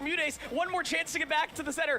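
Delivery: high, excited play-by-play call; esports caster. Real, not acted. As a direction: call the scramble like a hyped caster. Live hype play-by-play.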